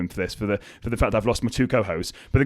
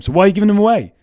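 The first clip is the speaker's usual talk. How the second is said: louder, higher pointed question; raised challenge; 7 semitones higher, 7 dB louder, faster than usual. Real, not acted. Coach pressing listeners on giving energy away, raised. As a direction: push them to see it.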